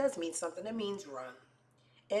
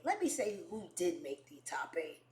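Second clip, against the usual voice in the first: hushed, lower snooping aside; sly near-whisper; 3 semitones lower, 8 dB quieter than usual. Retold or imitated. Snooping through his top eight, sly whisper. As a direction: drop to a conspiratorial whisper.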